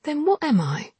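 high, taunting woman; sharp challenge; challenging question. Acted. Anna voiced, challenging.

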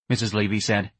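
usual speaking voice

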